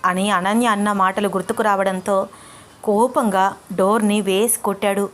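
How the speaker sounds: normal talking voice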